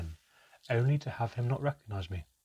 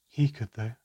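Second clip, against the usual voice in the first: bitterly; 4 dB louder than usual. Acted. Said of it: "Manka replied bitterly".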